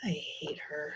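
whispered mumble; mumbled. A narrator's reading. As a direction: mutter it under your breath. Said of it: "Sprocket mumbled".

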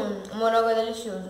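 speaking around a spoon; savoring while chewing; mouth full of yogurt. Real, not acted. Seen 0: Spoon with yogurt at her lips throughout; she tastes with eyes closed.

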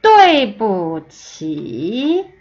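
对不起 very slow, sharp fall then a dip and rise; tone demonstration. Shown as a demonstration. Teacher modelling the whole phrase, 对不起 slow and toned. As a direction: hit 对, drop, then stretch 起 through its dip. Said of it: "한 번 더 들어보세요".

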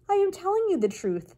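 high, insistent little voice; pleading mouse. A narrator's reading. Mouse swearing honesty to the king, high earnest plea.

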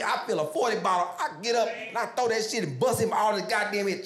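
usual speaking voice

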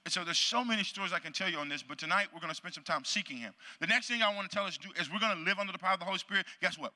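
ordinary talk, nothing marked

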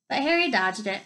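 ordinary delivery, nothing marked

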